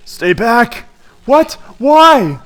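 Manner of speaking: high, loud startled game dialogue; hammed-up urgent exclamations; acted alarm. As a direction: cry out in mock panic. Acted urgent warning and startled reply.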